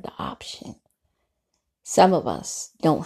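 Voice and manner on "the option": soft, breathy relish on the option; savoured whisper. Real, not acted. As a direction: whisper the word like a treat. Savouring a favourite word.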